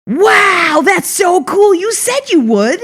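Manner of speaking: loud, high, thrilled exclamation; amazed. Retold or imitated. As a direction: imitate an astonished principal cheering her on. Retold principal amazed at the car.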